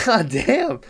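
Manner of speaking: high-pitched exasperated mock groan; mock exasperation. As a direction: let it out high, as if fed up with screaming. Turkey reacting to the screaming.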